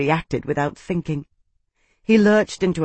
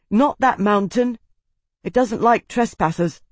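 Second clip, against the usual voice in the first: higher, insistent objection; urgent warning; 6 semitones higher than usual. A narrator's reading. Tavi warns the slave off the mountain, high urgent warning. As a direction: object urgently, voice raised in pitch.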